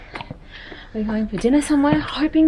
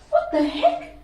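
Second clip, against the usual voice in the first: surprised. Real, not acted. Surprised at the broken couch.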